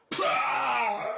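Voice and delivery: loud, high pained spit; spitting gasp. Acted. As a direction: spit it out after a punch. Spitting after a punch.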